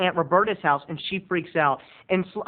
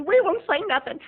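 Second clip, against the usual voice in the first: mock aunt voice; 11 semitones higher than usual. Aunts voiced in family story.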